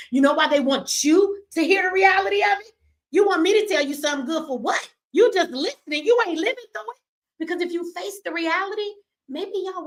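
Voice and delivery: high, crying through the anger; tears breaking into the rant. Real, not acted. Mother of a jailed son crying at her listeners.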